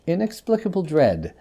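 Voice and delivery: usual speaking voice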